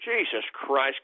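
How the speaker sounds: normal talking voice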